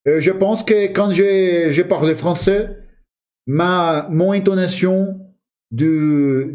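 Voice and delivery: French with a light French intonation; speaking French. Shown as a demonstration. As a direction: slip into French tune. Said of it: "parece que a nossa entonação muda".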